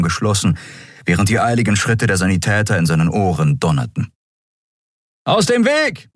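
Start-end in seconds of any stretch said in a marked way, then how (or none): 5.2-6.1 much higher call to clear way; shouted order. A narrator's reading. Someone clearing the corridor, a high shout. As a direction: shout people aside. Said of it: "rief jemand".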